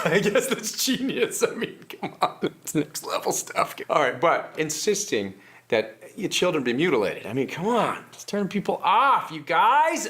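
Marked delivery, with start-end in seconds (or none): 0.0-3.8 laughing. Real, not acted. Laughing through words.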